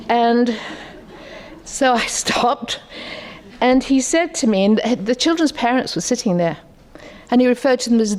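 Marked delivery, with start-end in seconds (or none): none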